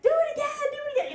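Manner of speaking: high, fast crowd chant; egging on. Retold or imitated. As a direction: chant it quickly like rowdy teenage boys. Voicing a crowd of boys egging on.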